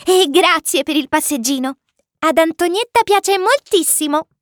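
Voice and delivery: put-on high, happy child voice; grateful little prince. A narrator's reading. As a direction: say thanks in a small piping voice. Little prince thanks the pilot happily. (vocina acuta e contenta)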